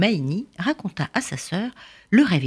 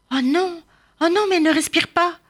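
higher and fast, panicked; alarmed cry; 13 semitones higher, 4 dB louder than usual. Acted. Discovering Maïni tied and not breathing, higher, alarmed. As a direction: rush it out in fright.

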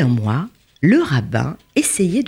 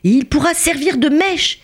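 high-pitched, eager exclamation; excited young man; 10 semitones higher than usual. A narrator's reading. Young prisoner offering cloth for a wick, excited cry. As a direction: burst out excitedly, eager to show everyone. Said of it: "tout excité, s'écria".

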